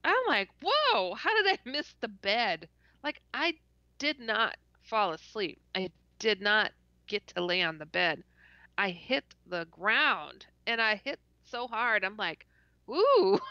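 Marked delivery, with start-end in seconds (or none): 0.6-2.7 high-pitched startled whoa, easing down; retold astonishment. Retelling her fall to the floor. 12.9-13.4 high, loud, drawn-out ooh; mock reaction to a hard bump; retold startled ooh. Retelling hitting the ground hard.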